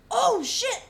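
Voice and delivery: loud, high burst of shock; shocked exclamation. Retold or imitated. Recounting the stabbing to a friend: loud, shocked outburst. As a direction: gasp it out as it hits you. Realizing he was there.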